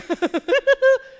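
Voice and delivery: high, loud burst of laughter; rising put-on laugh; imitated laughing fit. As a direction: act out catching the giggles. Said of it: "Then I start laughing".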